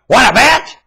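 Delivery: sudden loud scornful cry; furious outburst. Real, not acted. Ranter mocks careless parents, loud scornful cry. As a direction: bark it high and loud.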